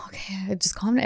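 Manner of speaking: soft whisper to herself; hushed reassurance under her breath; whispered self-calming. Retold or imitated. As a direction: whisper it to calm yourself down. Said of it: "I was like".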